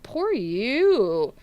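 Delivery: mock pity. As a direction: taunt with exaggerated pity. Mock pity taunting online heckler.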